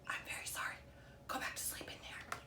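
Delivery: hushed and gentle; soft whisper. A narrator's reading. Goose apologising to the egg in a soft whisper. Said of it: "whispered softly".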